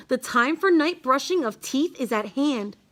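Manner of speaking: ordinary delivery, nothing marked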